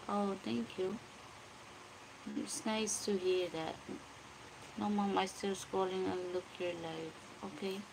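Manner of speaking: ordinary delivery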